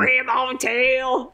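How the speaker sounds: put-on French accent. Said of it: "French accent".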